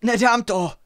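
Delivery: high, loud wail of giving up; desperate cry. A narrator's reading. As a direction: cry out while falling behind. Struggling runner answers the callers.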